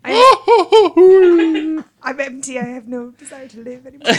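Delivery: high, loud hammed-up wail; mock despair. Host playing a spent can, mock despairing. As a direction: laughingly wail as if dying. Voices an emptied nitrogen can dying.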